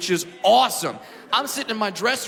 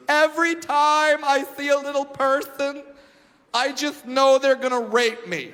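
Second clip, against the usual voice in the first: lightly voiced anxious woman, slowish; a hint of a worried woman; the fearful woman; 6 semitones higher than usual. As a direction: confide the fear earnestly as her. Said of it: "She goes".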